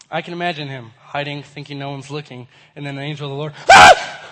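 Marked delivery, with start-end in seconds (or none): 3.7-4.2 sudden loud startled scream, low and raw; a sharp yelp of someone caught off guard. Acted. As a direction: let out a jolting scream of fright. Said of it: "you freak out like I just screamed".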